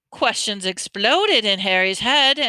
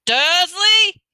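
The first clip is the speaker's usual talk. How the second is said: huge call, much higher and louder; booming shout; 11 semitones higher, 9 dB louder than usual. A narrator's reading. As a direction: boom the name across the hut. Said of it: "he boomed".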